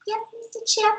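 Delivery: high, soft obedient reply; lightly voiced meek dealer girl; a hint of a timid gnome girl. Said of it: "the girl goes".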